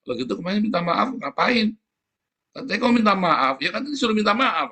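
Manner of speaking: high, indignant mock complaint; mocking exasperation. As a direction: scoff at the empty apology.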